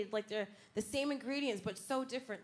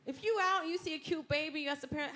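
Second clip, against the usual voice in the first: normal talking voice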